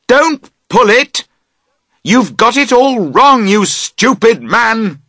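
high, loud, slow exasperated yell; crying out. A narrator's reading. Camper yelling at his friend to stop pulling, loud exasperated yell. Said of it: "you cry".

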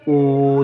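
ऊ held out as "ooo"; long oo sound. Shown as a demonstration. Teacher showing learners why it is long, drawn-out ऊ. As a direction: stretch ऊ into a long vowel. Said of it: "देर तक हो रहा है".

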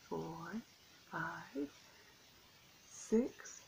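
plain reading voice

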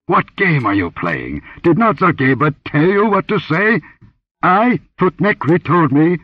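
furious priest. Acted. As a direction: threaten her like a striking cobra. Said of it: "his voice hissed like a cobra".

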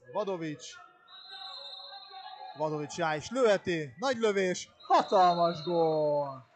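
rising to a loud, high goal call; excited hungarian sports commentary. Real, not acted. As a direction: shout the goal, climbing with the shot. (izgatott, harsány gólkiáltás)

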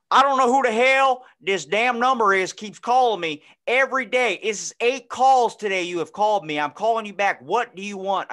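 hammed-up impression of an angry late-night caller; his voice. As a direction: rant like a furious old man on the phone. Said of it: "I'll give you his voice".

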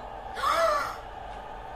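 high startled gasp. Real, not acted. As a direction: gasp at a sudden sight. Sees the Huggy statue.

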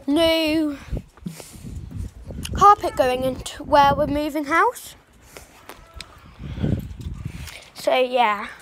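talking while chewing gum; words round a wad of gum. Real, not acted. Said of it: "I got chewing gum in my mouth".

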